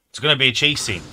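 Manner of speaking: loud panicked realisation; panicking. Real, not acted. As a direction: blurt it out in alarm. Chase begins, repeated louder.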